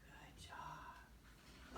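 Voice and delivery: whispered good job; hushed encouragement to the class; whispered praise. Real, not acted. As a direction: breathe it softly and low. Praising kids quietly.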